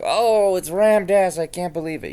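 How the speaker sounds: high, loud gushing imitation of a fan; mock starstruck exclamation, starting loud and easing off; excited. User imitating the Doctor spotting Ram Dass, excited. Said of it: "You seemed excited".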